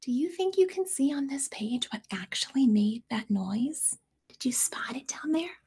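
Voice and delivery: whisper. Real, not acted. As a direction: lean in and whisper. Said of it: "whispering to listener".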